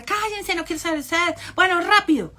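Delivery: high, loud bossy mock tirade; imitated yelling. Comedian threatening family into a photo pose, mock angry shouting. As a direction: shout threats, parodying a tyrant. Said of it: "empezó a gritar".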